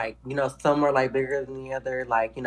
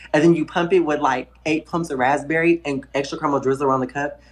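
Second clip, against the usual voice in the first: usual speaking voice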